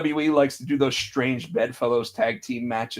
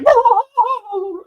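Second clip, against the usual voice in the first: much higher, loud yapping whoa; yappy attack dog; 8 dB louder than usual. Retold or imitated. Imitating Jey as an attack dog, high loud whoas. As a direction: yap it like a little attack dog. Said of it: "the little attack dog was Jey Uso".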